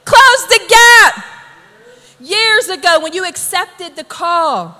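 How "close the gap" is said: high, loud, slow exhortation; preacher's shouted charge; emphatic command. Real, not acted. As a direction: punch it out to the whole congregation. Preacher repeats his charge to congregation.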